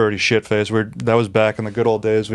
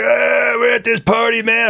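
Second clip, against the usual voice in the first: mimicking a drunk buddy; 12 semitones higher than usual. Mimicking the debtor's party invite in a high party-dude voice. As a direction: play the careless friend, pitched way up. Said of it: "He's like".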